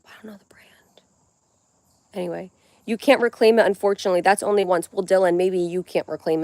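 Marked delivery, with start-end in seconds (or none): 0.1-1.0 whispered aside; whispering. Real, not acted. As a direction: breathe it, no voice.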